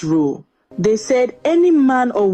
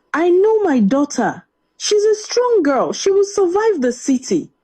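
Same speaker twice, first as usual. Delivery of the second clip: a proud father's voice, pitched higher, confident; father speaking; 9 semitones higher than usual. A narrator's reading. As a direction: reassure the mother that their strong daughter will be fine. Father voiced reassuring his wife.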